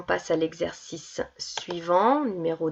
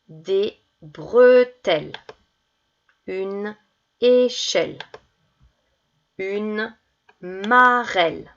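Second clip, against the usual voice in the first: put-on words drawn out slowly for children to write; slow dictation; much slower than usual. Shown as a demonstration. (dictée lente et articulée)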